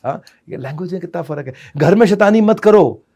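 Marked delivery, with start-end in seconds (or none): 1.8-3.0 loud high scolding; shouted no. Shown as a demonstration. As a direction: snap it like an angry parent.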